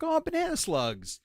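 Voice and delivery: high-pitched imitation of a delighted discovery; imitating wife. Storyteller imitates wife discovering banana slugs, high mock wife voice. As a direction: put on a high voice for her exclamation.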